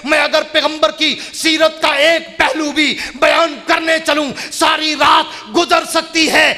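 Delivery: rising into a loud, driving preacher's chant; preaching at full force. Real, not acted. Preaching crescendo of Quran verses. (जोशीली तक़रीर, आख़िर में गरजती हुई)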